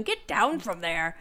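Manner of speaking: slow, drawn-out Scottish Scotty impression; lightly done Scottish Scotty voice, slowed; Scotty's Scottish accent. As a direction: bark it like Scotty yelling at kids, Scottish accent. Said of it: "in his accent".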